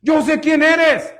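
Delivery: loud, high, slowed cry; acted fearful shouting, each phrase drawn out; imitated demon's shout. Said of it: "Él empezó a gritar".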